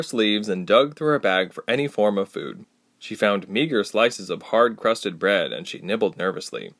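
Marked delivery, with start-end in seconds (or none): none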